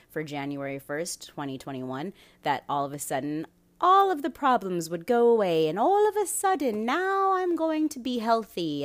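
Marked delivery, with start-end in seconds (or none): 3.8-8.8 high, loud, sing-song mockery; mock magical thinker. Podcaster mocking people who expected a magical new year, hammed-up. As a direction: swoop up in exaggerated delight. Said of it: "Maybe some people magically thought".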